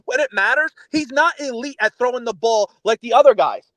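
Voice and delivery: ordinary delivery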